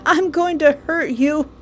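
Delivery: hammed-up tearful threat; mock crying. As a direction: whimper the threat through mock tears. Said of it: "I'm crying because".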